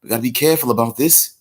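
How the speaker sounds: high, excited Australian wildlife-host voice, mock Australian accent; hushed Australian nature-show host stalking his prey; crocodile hunter impression. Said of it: "I gotta do my crocodile hunter".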